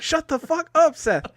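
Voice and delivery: high mock shout; Michael Cera's character snapping at Seth; mock yell. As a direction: yell it back like a brat. Cera yelling back at Seth.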